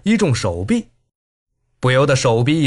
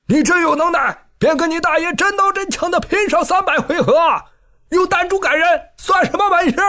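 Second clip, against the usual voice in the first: high taunting shout; 12 semitones higher than usual. A narrator's reading. Lin Yulong taunting Ren Feiyan while running, high shout. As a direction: yell high over your shoulder. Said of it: "摇摇叫道".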